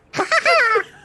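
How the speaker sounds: high, loud cackling laugh; loud burst of laughter. Real, not acted. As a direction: crack up loudly.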